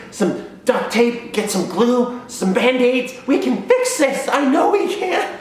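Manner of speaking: high, frantic begging; child pleading not to get dad; pleading kid. Retold or imitated. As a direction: beg desperately, words tumbling. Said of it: "And I was like".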